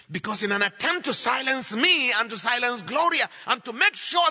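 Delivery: high, loud triumphant proclamation, easing at the end; full-force preaching. Real, not acted. Preacher proclaiming the gospel's victory, full-force. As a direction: shout it out to the whole congregation.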